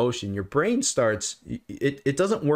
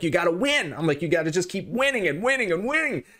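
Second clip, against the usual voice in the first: higher, rising push on each winning; pumped-up coaching; 8 semitones higher than usual. Retold or imitated. Answering a podcast host on self-esteem, fired-up and higher. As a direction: hype up a listener like a coach.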